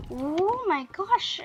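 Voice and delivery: high, slow, breath-held shock; scared. Retold or imitated. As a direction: draw it out slowly in mock alarm. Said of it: "it scared me".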